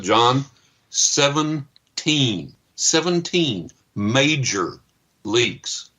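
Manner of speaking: seventeen drawn out very slow and high; dramatic emphasis. Retold or imitated. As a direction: land each word of seventeen major leaks. Plumbers announce shocking number.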